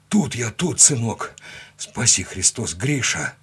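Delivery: emotional father, voice slightly wavering; slight tremor. A narrator's reading. Father bending over his revived son, voice slightly trembling. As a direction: reassure your son, then give moved thanks. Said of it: "голос слегка дрогнул".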